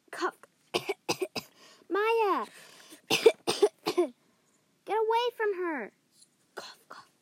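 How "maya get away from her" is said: loud frantic yell; alarmed shout. Acted. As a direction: yell to drive them off. Ghosts take her breath.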